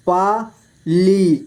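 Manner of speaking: पाली split slowly into पा, ली; syllable by syllable. Shown as a demonstration. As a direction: stretch and separate पा and ली in Pali. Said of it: "इस तरह से आपको". (पाली को तोड़कर धीरे: पा, ली)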